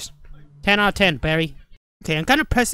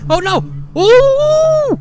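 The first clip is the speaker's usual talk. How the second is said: high, loud squeal of fright; sudden scared squeal; startled yelp; 8 dB louder, much slower than usual. Real, not acted. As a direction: shriek at the jump scare. Jump scare.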